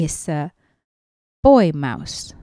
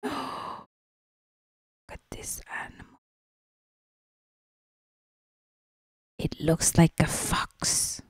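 gasping whisper; 8 dB quieter than usual. Real, not acted. Whispered gasp about fox.

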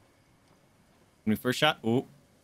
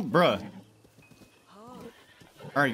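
ordinary talk

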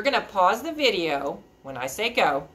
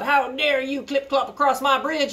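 high, raised troll scolding; hollering troll; 9 semitones higher, 5 dB louder than usual. Acted. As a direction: holler at the trespassing goat. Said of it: "he hollered".

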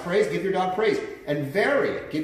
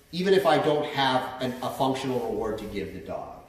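normal talking voice

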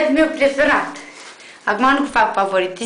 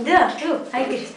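usual speaking voice

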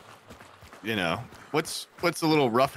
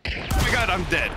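higher, louder shocked outburst; 7 semitones higher, 5 dB louder, faster than usual. Real, not acted. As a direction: react to sudden death. Got killed in game.

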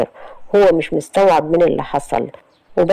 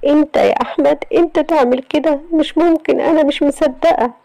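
higher, tearful shocked voice; a touch of tearful disbelief; crying in disbelief; 11 semitones higher than usual. Acted. Yasmin shocked by Ahmed's confession, tearful and high. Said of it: "وقعدت تعيط".

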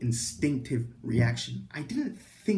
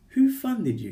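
condescending; 8 semitones higher, 6 dB louder than usual. Retold or imitated. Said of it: "feels like they're talking down on you".

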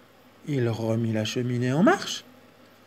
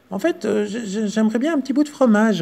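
higher hesitant squeak; timid stammering mouse; 8 semitones higher, 3 dB louder than usual. Acted. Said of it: "risqua la souris".

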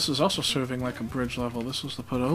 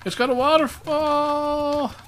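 hammed-up high-pitched lament; mock wail; 11 semitones higher than usual. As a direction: hold the last word long and high. Mock lament over a waterfall.

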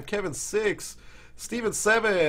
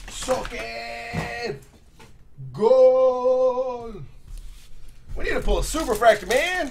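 loud, high excited yell; excited shout; 8 semitones higher, 8 dB louder, slower than usual. Real, not acted. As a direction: celebrate the big hit. Big card pull, shouting.

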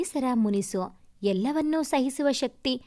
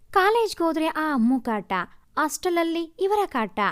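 ordinary delivery

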